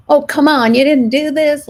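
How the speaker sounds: irritated nagging. Retold or imitated. Parent scolding a family member over chores, irritated and loud. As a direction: snap at them like a fed-up parent. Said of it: "my irritated voice".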